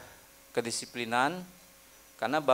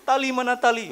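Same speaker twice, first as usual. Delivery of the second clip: high, hammed-up self-pity; mock melodrama, much higher; oversensitive whining; 10 semitones higher, 4 dB louder than usual. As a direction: play the hurt intern as a wounded drama queen. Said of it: "Ah, baper".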